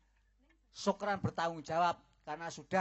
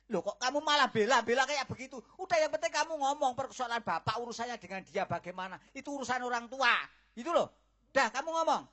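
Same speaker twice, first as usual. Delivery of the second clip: loud, higher and fast; angry rant; 6 semitones higher, 7 dB louder, faster than usual. Acted. Father angrily insists the boy must take responsibility. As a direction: rail at her, barely pausing.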